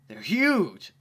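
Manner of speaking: loud, high exclaim; excited. Acted. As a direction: blurt it out thrilled. Excited character over fruit.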